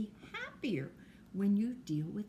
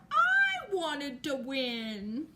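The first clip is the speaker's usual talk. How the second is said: put-on slow, loud, higher whiny girl's voice; sulky little girl; 5 semitones higher, 7 dB louder, much slower than usual. Girl who lost the game, slow whiny complaint. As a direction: drag out the words like a child who lost. Girl character sulking after losing.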